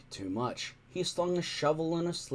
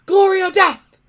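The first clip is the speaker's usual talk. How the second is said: much higher, loud battle yell; war cry; 15 dB louder than usual. A narrator's reading. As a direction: roar it like a crowd of warriors. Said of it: "yelled everybody".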